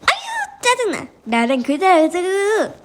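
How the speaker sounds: irritated, high-pitched whine turning to nagging; acted exasperation in a squabble; noisy squabbling. A narrator's reading. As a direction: bicker crossly, swinging high on the complaints. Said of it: "시끌벼덕한 말다툼 소리가 들려왔어요".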